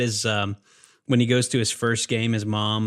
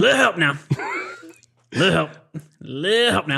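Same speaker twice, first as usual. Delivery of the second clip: Brickma voice; 8 semitones higher than usual. Shown as a demonstration. Quoting Brickma's plea in a high voice for his friend. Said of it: "you say it like Brickma".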